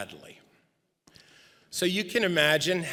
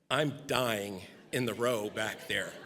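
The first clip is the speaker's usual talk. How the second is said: ordinary delivery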